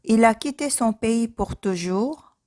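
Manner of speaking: ordinary delivery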